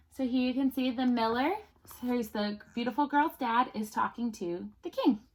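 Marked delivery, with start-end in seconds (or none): none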